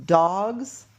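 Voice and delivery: "Dogs" said loud with a rise; stressing the word. Shown as a demonstration. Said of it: "put boxes like this and say".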